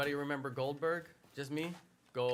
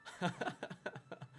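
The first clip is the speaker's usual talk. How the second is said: laugh; 4 semitones higher, 7 dB quieter than usual. Real, not acted. Laughing about old wrestling leagues.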